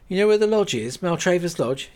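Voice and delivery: pressing for reassurance; Kate voiced, anxious question. Kate anxiously asks Rose if she knows the lodge.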